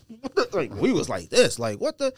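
high, startled mock disbelief; shocked. Opening a box of moldy sneakers, mock shocked disbelief. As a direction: pitch jumps up in mock shock.

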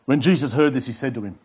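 ordinary talk

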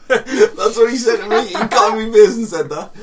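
high, loud laughing; laughing hard. Real, not acted. Telling his friend the story, laughing hard. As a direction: crack up mid-sentence.

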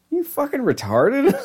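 lightly voiced gang member, mocking disbelief; incredulous gang guy. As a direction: stretch it out like he can't believe it. Retold gang member confronting him.

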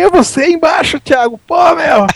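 high astonished shout. Retold or imitated. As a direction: call out in disbelief. Retold shout at short friend.

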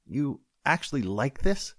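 disgusted deputy. Acted. Deputy inspecting the chili, high and slow disbelief. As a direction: recoil at the bowl in disbelief.

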